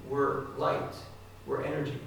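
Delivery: ordinary delivery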